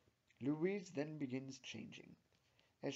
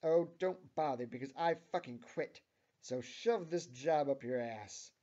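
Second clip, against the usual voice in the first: loud, angry resignation; 2 semitones higher, 10 dB louder than usual. A narrator's reading. Tammy quitting to her manager Steve, angry. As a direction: quit with raised, heated voice. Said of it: "says Tammy angrily".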